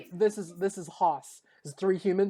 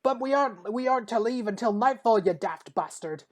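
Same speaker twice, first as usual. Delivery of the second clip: indignant dwarf; 5 semitones higher than usual. Acted. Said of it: "Haas is like".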